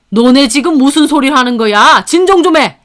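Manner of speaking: loud, rising demand to calm down; alarmed intervening. A narrator's reading. Father-in-law interrupts the quarrel, alarmed and loud. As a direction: break up the row loudly.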